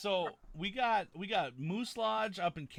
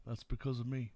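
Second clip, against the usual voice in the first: straight-faced claim; 4 semitones lower, 3 dB quieter than usual. Pretend. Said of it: "with a very straight face and a political promise".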